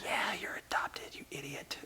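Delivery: whispered sneer at a sibling; mock whisper. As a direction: hiss it like a mean older brother. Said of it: "the other brother's like".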